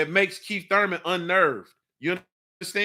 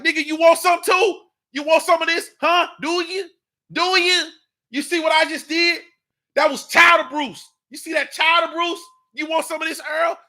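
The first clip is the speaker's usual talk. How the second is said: shouted, higher challenge to a rival boxer; pretend trash talk; 8 semitones higher, 5 dB louder than usual. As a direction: bark it like a fighter calling someone out in the ring. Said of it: "you supposed to say".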